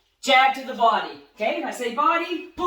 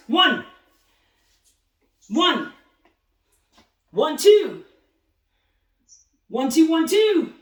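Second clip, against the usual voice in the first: shouted counts; 3 semitones higher, 6 dB louder, slower than usual. Shown as a demonstration. Coach calling punches to boxers, loud counts. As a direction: bark each count out to the class. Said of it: "ready, and let's go".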